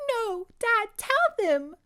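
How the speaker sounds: high, desperate plea; sobbing girl. Acted. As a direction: beg her father through tears.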